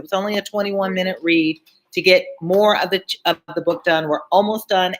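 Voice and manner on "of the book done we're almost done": plain reading voice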